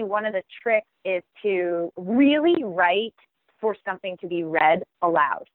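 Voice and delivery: ordinary talk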